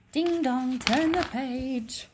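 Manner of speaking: playful sing-song ding dong; sing-song page cue. Pretend. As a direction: chime it like a little bell. Teacher voices page-turn cue.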